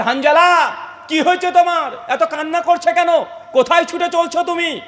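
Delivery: high, urgent preacher's shout; shouted questioning. Acted. Abu Bakr calling to the running, weeping Hanzala, shouting. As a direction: call out in alarm, pitched well up. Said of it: "চিৎকার দিয়ে বলছে".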